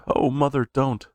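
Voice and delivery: put-on high, tearful child's plea; high-pitched crying don't; begging cry. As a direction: plead with mother not to hit. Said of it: "he cried".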